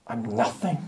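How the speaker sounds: hushed, small admission; a worshipper before God quietly admitting he is nothing; humbled confession. Acted. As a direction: let it fall away, overwhelmed. Worshipper realizing unworthiness before God.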